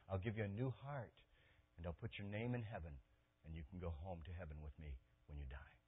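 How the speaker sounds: soft, low, tender appeal; hushed and inviting, as Christ calling someone home; tender invitation. Acted. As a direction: invite them gently, voice dropped low. Voicing Christ's gentle invitation.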